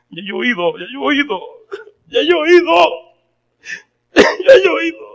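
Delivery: rising from a tearful plea to a high, loud sob; crying, breaking down. Retold or imitated. Man breaking down after second sermon. (llanto que sube hasta quebrarse en un grito agudo)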